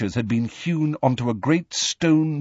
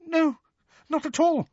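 very high tight squeak. A narrator's reading. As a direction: squeak it out bravely. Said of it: "squeaked Hermione".

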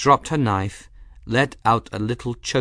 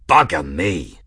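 burly aeronaut; 4 semitones lower, 4 dB louder than usual. A narrator's reading. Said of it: "blurted a burly aeronaut".

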